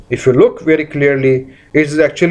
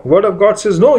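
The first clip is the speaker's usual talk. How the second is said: ordinary talk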